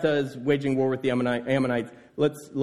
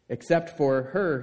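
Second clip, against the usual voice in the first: normal talking voice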